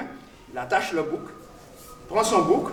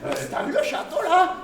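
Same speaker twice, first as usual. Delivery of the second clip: mock king; 9 semitones higher than usual. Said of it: "Le roi lui dit".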